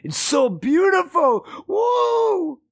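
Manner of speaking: slow, then crying out high in awe; hammed-up weeping wonder at the rainbow; overwhelmed awe.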